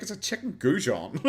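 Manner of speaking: usual speaking voice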